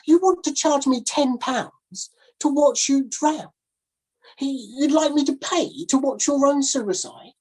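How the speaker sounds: the gentleman refusing the sailor's grim show; appalled gentleman. Acted. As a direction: recoil from the idea in prim horror. Gentleman voiced in story.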